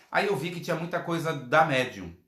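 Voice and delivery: ordinary talk, nothing marked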